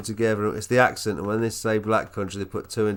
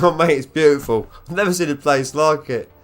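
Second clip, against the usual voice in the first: mock gushing; 5 semitones higher, 8 dB louder than usual. Mock-gushing reply to game dialogue.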